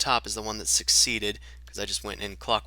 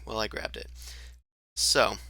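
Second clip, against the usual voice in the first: normal talking voice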